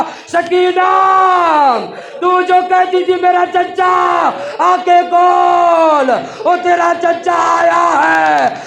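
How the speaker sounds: loud, high lament, stretched out slowly; grieving call. A narrator's reading. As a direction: call out to the dead child in grief. Brother mourns at Sakina's grave. (ऊँची, धीमी मातमी पुकार)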